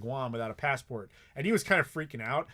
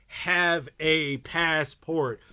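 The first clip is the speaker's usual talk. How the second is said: "have a passport" spaced out very slowly and louder; emphatic urging; 5 dB louder, much slower than usual. Real, not acted. Streamer urging viewers to travel prepared, "have a passport" slow and emphatic. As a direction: hammer each word of "have a passport", slow and loud.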